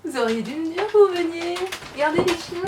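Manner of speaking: high, loud, gushing welcome to the dogs; sing-song greeting to excited dogs; excited dog talk. Real, not acted. Greeting visitors, talking to dogs.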